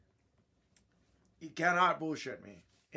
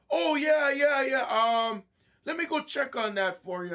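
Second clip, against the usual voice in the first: high, loud, hurried yes-yes of a caught-out clerk; flustered eager staff; 8 semitones higher, 6 dB louder than usual. Retold or imitated. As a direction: scramble to please the angry customer. Flustered staff voiced in story.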